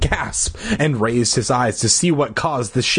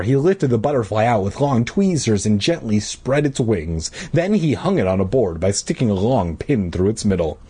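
usual speaking voice

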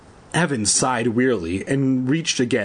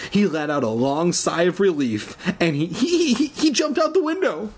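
ordinary delivery

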